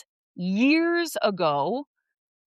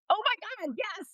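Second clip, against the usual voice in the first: excited; 14 semitones higher, 6 dB quieter than usual. Retold or imitated. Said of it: "I was like".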